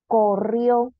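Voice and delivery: corrió with a firm, loud RIÓ; loud classroom demo of corrió, end stressed; stress on last syllable. Shown as a demonstration. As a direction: push the final RIÓ of corrió. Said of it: "Última sílaba".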